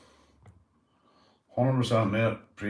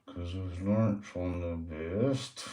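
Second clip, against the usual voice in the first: slow pet voice; 2 semitones lower, 5 dB quieter, much slower than usual. Pretend. Same pet voice replying.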